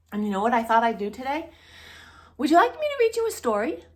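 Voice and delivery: put-on cheery children's show host greeting; bright hostess voice. Valerie welcoming a child to story time, cheery. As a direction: welcome the little viewer warmly.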